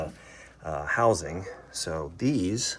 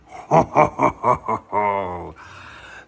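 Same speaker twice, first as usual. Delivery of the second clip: delighted laugh; 6 dB louder than usual. Real, not acted. Laughing at new wheels.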